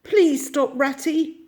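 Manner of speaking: high, loud, desperate plea; anguished cry for a friend to wait; pleading in anguish. A narrator's reading. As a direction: beg him to stop. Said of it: "pleaded the Mole in anguish".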